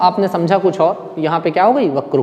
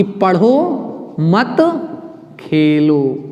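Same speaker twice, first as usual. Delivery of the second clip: पढ़ो set apart, then मत खेलो slow and drawn out; pause after पढ़ो; 2 semitones lower, much slower than usual. Shown as a demonstration. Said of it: "बोलने या सुनने की त्रुटि के कारण". (पढ़ो के बाद विराम, फिर मत खेलो)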